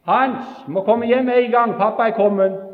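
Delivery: loud, high call across the woods. Retold or imitated. Brother calling Hans home, loud and high. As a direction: shout for him to come home. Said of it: "så ropte han".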